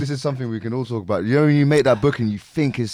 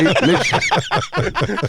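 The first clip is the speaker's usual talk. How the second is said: laughing; 8 semitones higher, much slower than usual. Real, not acted. Laughing while telling the story.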